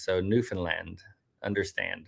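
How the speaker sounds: Newfoundland as new-fin-LAND, low and soft; full "and" vowel ending both Newfoundland and understand; rhymes with understand. Shown as a demonstration. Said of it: "Rhymes with understand".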